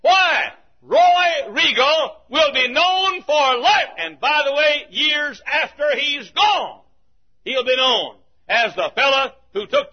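full-voiced revival sermon build; preaching. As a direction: drive the point home from the pulpit.

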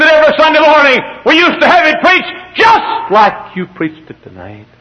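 high, loud cry that eases off; acting out an overwrought custodian; impassioned imitated outcry. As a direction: cry it out at full pitch, then come down. Voicing the custodian's impassioned memory.

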